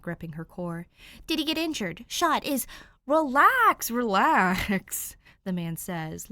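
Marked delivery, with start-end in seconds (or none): 1.3-2.7 panicked. A narrator's reading. Panicked questions. 3.1-5.2 reassuring. A narrator's reading. Man calming her, laughing.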